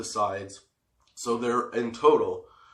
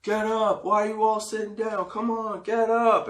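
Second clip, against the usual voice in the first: higher, calling out to the crowd; rock star hyping crowd; 9 semitones higher than usual. Retold or imitated. [name] urging the seated crowd to stand, calling out. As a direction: fire up a seated audience. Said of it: "And then he goes".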